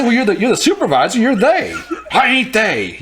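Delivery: high-pitched, hammed-up retold argument; heated argument. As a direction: retell the squabble with rising pitch. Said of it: "he gets, like, he gets heated".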